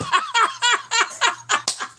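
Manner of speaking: real high cackle; high burst of laughter. Real, not acted. Streamer cracking up at a viewer's joke with a high laugh.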